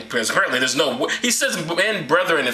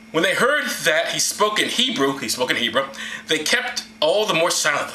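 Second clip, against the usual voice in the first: ordinary talk, nothing marked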